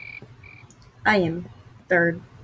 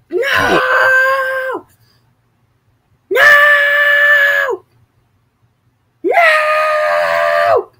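very high, drawn-out wail; stretched high-pitched cry; mock despair; 5 dB louder, much slower than usual. Losing out in the game.

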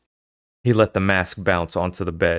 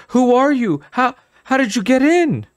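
put-on terrified child; 7 dB louder than usual. Terrified boy facing monster.

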